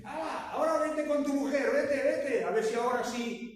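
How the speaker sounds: put-on a king shooing his drunk guest home, cheering him on; jovial king. King David urging drunk Uriah home, high hearty shout.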